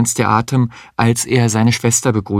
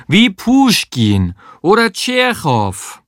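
hammed-up Russian accent, called out high; mock Russian accent; 9 semitones higher, slower than usual. Sabine yelling from the bathroom, in an exaggerated Russian accent. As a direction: cry it out as Russian as you can. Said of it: "so russisch sie konnte".

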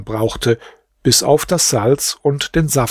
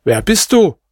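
high, sharp challenge to a caller; calling out; 8 semitones higher, 4 dB louder than usual. A narrator's reading. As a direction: call out the question, pitch jumping up. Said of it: "Scheich Ibrahim rief".

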